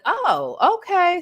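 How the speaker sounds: usual speaking voice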